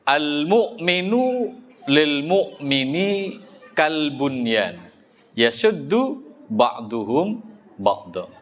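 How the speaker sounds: slow, chanted Arabic recitation; Arabic hadith recitation. Acted. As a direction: intone the hadith slowly like Quran reading. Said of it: "qala Rasul sallallahu alaihi wasallam".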